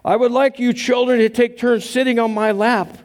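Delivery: Santa voice. Retold or imitated. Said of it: "Santa went on".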